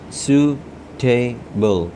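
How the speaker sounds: Suitable drawn out very slowly; slow careful syllables. Shown as a demonstration. Teacher modelling suitable slowly for the class. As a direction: stretch suitable sound by sound.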